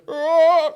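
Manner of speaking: effortless tenor blast, high and loud; sudden belted opera note. Retold or imitated. Imitating a chilled singer, loud operatic note. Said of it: "he's just chilling".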